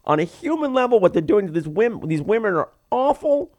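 high, hand-wringing fret; mock fretting lady. Doctor Mommy torn between her faith and her job, fretting. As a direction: agonize over morality in a prissy voice. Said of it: "torn in two directions".

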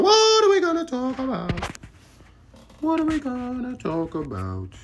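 very high sing-song question; high falsetto whine. Pretend. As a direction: ask it in a silly squeaky voice. Falsetto repeats.

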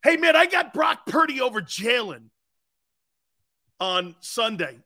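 high, loud put-on claim; mock declaration. Shown as a demonstration. Host shows Tone a take he can't believe, mock-confident shout. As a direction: proclaim it like a bold hot take. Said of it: "Tone, watch this".

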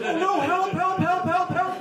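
high-pitched panicked pleas, repeated rapidly as if begging silently for rescue; mock terror. Doctor stuck on a patient's hard surname, mock terror. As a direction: imitate a terrified doctor crying for help. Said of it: "the most terrified look in his eyes".

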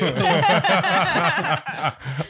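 high burst of laughter. Real, not acted. Reacts to "why do you.

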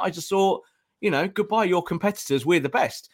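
ordinary talk

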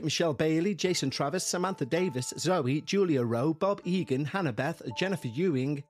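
normal talking voice

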